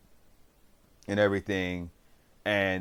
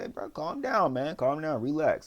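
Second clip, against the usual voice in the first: higher-pitched imitation of calming a friend; urgent calming; 7 semitones higher than usual. Suho talking his angry friend down, urgent calming. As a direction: plead to settle him down, retold.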